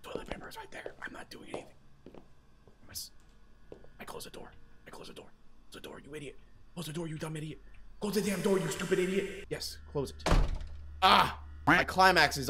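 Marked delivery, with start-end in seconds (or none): 0.0-10.3 whisper. Real, not acted. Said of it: "She sees the toilet paper".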